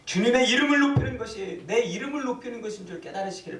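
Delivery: high-pitched exhortation to the congregation; fervent preaching. Real, not acted. Preaching at full force. (높은 목소리로 힘주어 축원하는 설교)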